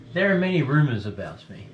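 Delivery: hearty abbot welcoming guests; a lightly booming friar's welcome; jolly booming friar. Acted. GM voices the abbot.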